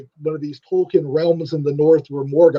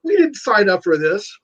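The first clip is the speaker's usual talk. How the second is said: panicked orc; 5 semitones higher than usual. Retold or imitated. Orcs shocked as the trees attack them, mock panic. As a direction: squeal it like a terrified orc grunt. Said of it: "It's like, what".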